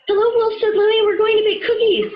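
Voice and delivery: high, cheery character voice; Louie's voice. Acted. Louie welcoming Wolf to bake cookies, cheery. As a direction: greet him brightly like a happy baker.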